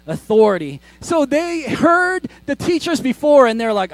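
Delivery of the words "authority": Authority said low and loud, falling. Shown as a demonstration. Preacher has the church repeat the word, stressed. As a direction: lean into Authority with weight. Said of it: "Say authority".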